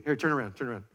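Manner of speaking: ordinary talk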